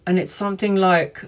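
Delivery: ordinary talk, nothing marked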